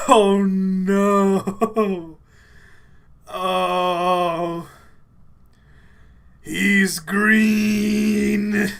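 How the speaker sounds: high, loud, drawn-out wail after laughing; helpless wail. Real, not acted. Overcome after hysterical laughing.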